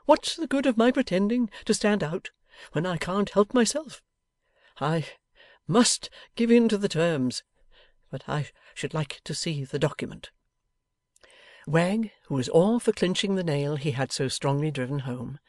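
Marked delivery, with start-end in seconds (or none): 0.1-10.3 forlorn. A narrator's reading. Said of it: "in a forlorn manner".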